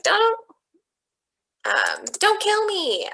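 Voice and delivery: high, pleading mock fear, voice jumping up; scared begging. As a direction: act out a frightened plea for his life. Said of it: "is scared to talk to Rikki".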